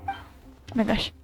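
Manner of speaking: normal talking voice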